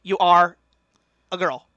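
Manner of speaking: put-on loud whiny little kid voice; mock nasal pundit, punched out; Ben Shapiro. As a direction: imitate a nasally debater posing a hypothetical. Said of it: "that whiny little kid voice".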